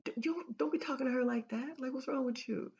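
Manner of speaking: exaggerated high-pitched challenge; Bob Proctor confronting. Man defending a lady from a rude husband, mock confrontational. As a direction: front someone out, comically overdone. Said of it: "he didn't say it like that".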